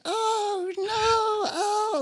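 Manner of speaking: very high panicked wail; high mock wail; terrified elephant. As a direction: cry out like a hurt toy. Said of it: "And she's like".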